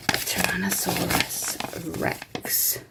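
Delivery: hushed secret whisper. Real, not acted. Guessing the dinosaur reveal.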